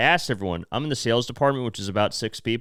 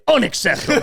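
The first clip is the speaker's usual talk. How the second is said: high, loud mock outburst; hammed-up indignant shout; imitating angry boss; 10 semitones higher, 5 dB louder than usual. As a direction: burst out like an outraged boss. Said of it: "just yell at people".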